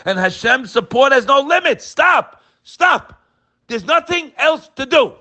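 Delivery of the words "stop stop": high, louder commanding stop; preacher silencing doubters; forceful stop. Acted. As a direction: cut them off hard, twice.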